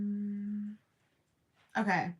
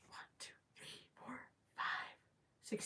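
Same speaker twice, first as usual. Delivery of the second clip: barely audible count under the breath; whispered counting; 16 dB quieter than usual. Real, not acted. Counting whale shark fins to herself, whispered.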